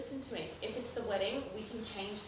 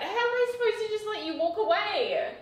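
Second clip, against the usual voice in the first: tearful pleading; 6 semitones higher, 4 dB louder than usual. Real, not acted. Fiancée begging her partner not to leave, tearful and high. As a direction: beg through real distress.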